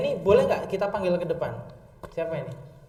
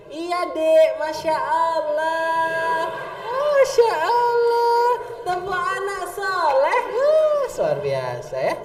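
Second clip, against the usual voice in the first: very loud, high, drawn-out Masya Allah; mock-sobbing praise for a child's essay; hammed-up awe; 9 semitones higher, 7 dB louder, much slower than usual. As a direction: wail the praise like a moved preacher. Overdone emotional praise.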